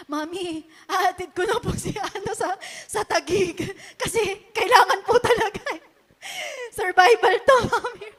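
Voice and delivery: imitating a son pleading with his mom, rising to a near-sob. Son begging his mother, high urgent pleading.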